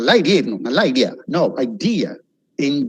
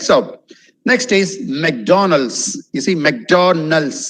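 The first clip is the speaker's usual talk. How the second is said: usual speaking voice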